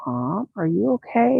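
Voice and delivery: put-on soft, slow frightened kid; scared kid. Terrified kids after mom yelled, soft and slow. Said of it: "they were terrified".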